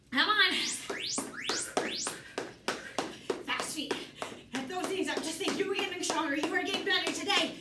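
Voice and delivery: upbeat motivating coach; aerobics instructor. Acted. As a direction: cheer them on. Coach motivating round six.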